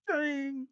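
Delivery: mock rim boing. Fan mocking the rim, a playful boing. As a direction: bounce the sound off the rim. Mimicking ball rattling rim.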